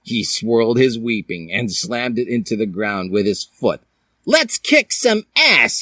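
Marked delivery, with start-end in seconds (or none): 4.2-5.8 very high, slow heroic cry; hero battle cry. Acted. Link rallying his friends before the fight, in a high slow squeal. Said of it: "he said".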